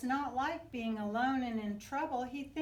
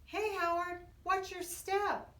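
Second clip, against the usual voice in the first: higher, louder friendly call; warning call; 9 semitones higher, 3 dB louder than usual. Acted. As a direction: shout a quick heads-up to a friend. Friend warns Howard.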